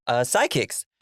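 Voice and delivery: usual speaking voice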